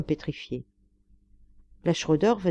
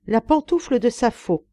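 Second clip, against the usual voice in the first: raised, declaimed solemnity; 6 semitones higher, 5 dB louder than usual. A narrator's reading. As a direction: proclaim it like a sacred relic. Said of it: "reprit le gentilhomme avec solennité".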